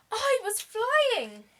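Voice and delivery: high, breathless and thrilled; excited panting. Acted. Tired penguin landing on the whale, panting high. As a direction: gasp it out after flying. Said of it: "he panted".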